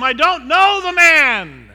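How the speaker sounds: cursing denial. Acted. Peter swearing to the crowd, slow loud curse. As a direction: spit out the oath. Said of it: "he began to call down curses".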